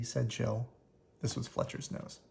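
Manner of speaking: ordinary delivery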